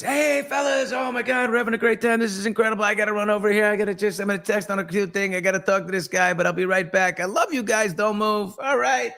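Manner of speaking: high, gushing mock party voice, shouting the greeting; a hyped-up reveller making excuses to slip away; overly excited partygoer. Partygoer gushing to friends before vanishing, high and hyped. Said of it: "The Irish goodbye is".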